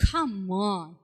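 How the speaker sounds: ordinary delivery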